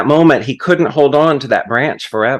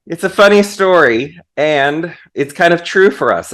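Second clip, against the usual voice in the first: normal talking voice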